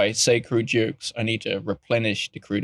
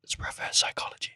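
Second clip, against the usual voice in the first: hushed, conspiratorial whisper; barely voiced whisper; whispered aside; 12 dB quieter than usual. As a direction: whisper it like a secret trick. Mock secret aside on trick.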